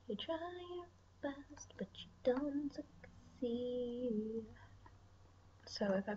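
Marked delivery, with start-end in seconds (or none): 0.1-4.4 soft whispered self-mockery, slowing at the end; rueful whisper. Real, not acted. Whispering to viewers after failing to draw horns. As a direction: whisper it like a sad confession.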